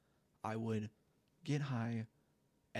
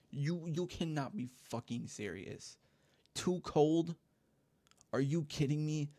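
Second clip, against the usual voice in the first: louder, acted-out disbelief; incredulous outrage; 3 semitones higher, 4 dB louder than usual. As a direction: throw the excuse back in exasperated disbelief. Acting out disbelief at cousin's excuse.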